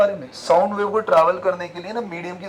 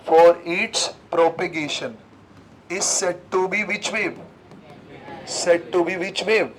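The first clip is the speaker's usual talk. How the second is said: very slow, drawn out, prompting the answer; slow dictation; much slower than usual. Shown as a demonstration. Teacher slowly dictating and quizzing students on the wave type. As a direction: dictate slowly, leave room to write. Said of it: "definition में क्या लिखूं".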